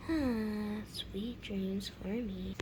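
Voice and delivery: very soft, slow drowsy voice; sleepy murmur. Acted. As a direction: sigh and drift off. Settling back to sleep.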